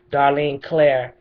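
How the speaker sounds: normal talking voice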